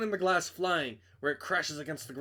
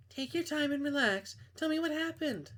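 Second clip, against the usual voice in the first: lightly raised voice for Camila, a friend calling; a hint of a young woman on the phone; girl voice; 12 semitones higher than usual. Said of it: "I can't do girl voices".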